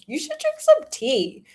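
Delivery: high, chirpy mock advice. She teases her friend with unsolicited advice. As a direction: trill it up high like a busybody. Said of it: "I was like".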